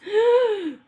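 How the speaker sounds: sharp high gasp of surprise. A narrator's reading. Dad, amazed at the dog, gasps loudly. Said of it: "Dad gasped".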